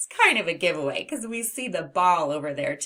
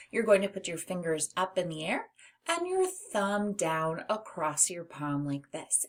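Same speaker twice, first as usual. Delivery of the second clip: ordinary talk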